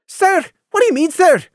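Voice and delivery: high, tight and strained. Acted. Lily tightly questioning Gloom, high and strained. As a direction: force it out tightly. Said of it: "Lily asked tightly".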